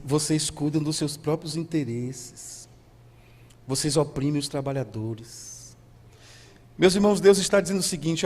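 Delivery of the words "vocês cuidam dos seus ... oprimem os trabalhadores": low, soft, measured reproach; God's grave rebuke. Acted. God answering the people about oppressed workers, grave rebuke. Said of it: "Deus vai dizer para eles assim".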